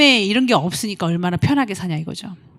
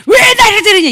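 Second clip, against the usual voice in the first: high, loud, indignant yell; mock furious retort; angry protest; 12 semitones higher, 11 dB louder than usual. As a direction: hurl the protest back. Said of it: "이렇게도 안 하셨지만".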